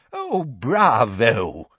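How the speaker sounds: put-on long drawn-out old-man cheer; delighted cry. A narrator's reading. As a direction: applaud slowly in an elderly quaver. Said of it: "cried Professor Tofty".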